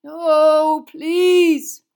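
loud, high pleading wail; moaned plea. A narrator's reading. Sirius begging as the Dementors come, moaning. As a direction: moan it loudly, begging. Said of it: "he moaned".

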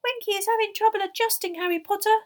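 high, squeaky elf voice; high-pitched house-elf squeak; confidential squeak. A narrator's reading. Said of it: "squeaked Dobby confidentially".

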